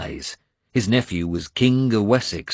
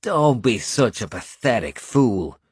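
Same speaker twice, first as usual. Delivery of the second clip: snarled; 3 semitones higher than usual. A narrator's reading. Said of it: "he snarled".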